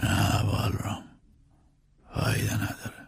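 slow, soft, whispered refusal; despondent whisper. Acted. Grieving master refusing a doctor, whispering hopelessly. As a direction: give up, with almost no voice.

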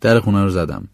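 ordinary talk, nothing marked

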